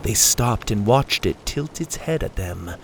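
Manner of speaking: ordinary delivery, nothing marked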